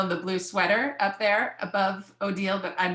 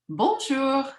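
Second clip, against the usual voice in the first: high, sing-out French hello; French greeting; 7 semitones higher, 4 dB louder than usual. Acted. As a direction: greet in a lifted storybook lady's voice.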